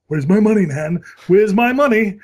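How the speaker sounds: loud, high mock demand; Family Guy quote. Retelling quoting the cartoon to his girlfriend, loud demand. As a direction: bark the line like the cartoon thug. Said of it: "episode of Family Guy where they did".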